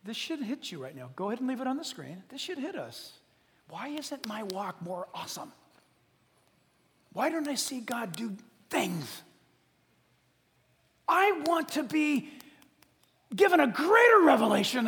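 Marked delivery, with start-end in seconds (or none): 3.7-9.2 whispered complaint. Retold or imitated. Preacher voicing a doubting believer, whispering. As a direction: breathe the complaint out.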